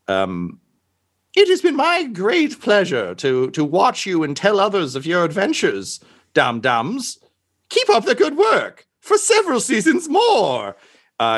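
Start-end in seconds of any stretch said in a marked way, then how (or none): 1.3-10.8 put-on high, grand, warm old showman; sweeping grand farewell. Robed figure sends off the party, grand high voice. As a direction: beam with pride as you send them off. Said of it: "and he just says".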